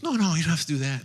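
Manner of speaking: high, quick flustered no, no; a woman waving off a stranger's kindness; protesting woman. Retold or imitated. Mother at the gas pump politely refusing, high and hurried.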